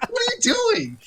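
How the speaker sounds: very high, incredulous squawk; mock exasperation. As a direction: protest in comic disbelief, pitch way up. Teller objects to contact's plan.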